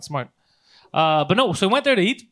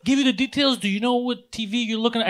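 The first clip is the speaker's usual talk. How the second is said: eager salesman; 6 semitones higher than usual. Retold or imitated. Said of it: "He's excited".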